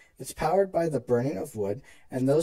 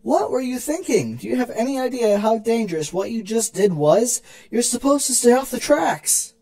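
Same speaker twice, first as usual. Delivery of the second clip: higher and louder, a frightened big sister scolding; panicked scolding; 7 semitones higher, 5 dB louder than usual. Acted. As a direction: yell at her to get off the tracks, out of breath. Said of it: "Celestia was panting heavily".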